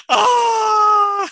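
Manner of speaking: high loud mock crying wail; a comic imitation of a big crying outburst; imitated wail. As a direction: belt out a hammed-up sob-wail. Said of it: "And she's like".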